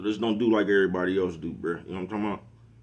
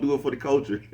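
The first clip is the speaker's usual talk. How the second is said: chuckling through the words, a bit higher; laughing; 5 semitones higher than usual. Real, not acted. Vlogger mocking news-hungry viewers, laughing. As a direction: laugh it out at your own joke.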